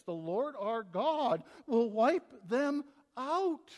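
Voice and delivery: slow, weighty prophetic declaration; slow, grave scripture proclamation; solemn proclamation. Shown as a demonstration. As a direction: pronounce it slowly like an ancient prophet. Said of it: "We don't talk like this too much anymore".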